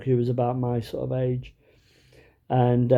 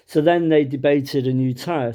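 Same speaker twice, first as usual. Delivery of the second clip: normal talking voice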